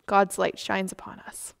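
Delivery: normal talking voice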